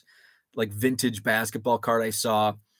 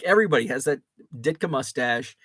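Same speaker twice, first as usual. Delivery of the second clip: ordinary delivery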